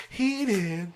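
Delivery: high-pitched worked-up exclamation; getting heated. Real, not acted. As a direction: pipe it up high as you overheat. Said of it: "I can feel myself getting heated now".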